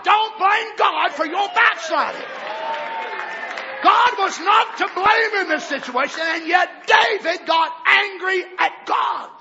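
loud, high, slow rebuke; rebuking shout. Real, not acted. Preacher rebuking the church for blaming God. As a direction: hammer it home.

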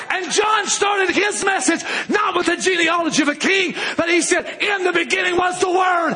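high, ringing sermon shout; full-force preaching. Real, not acted. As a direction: preach it out with long, held key words.

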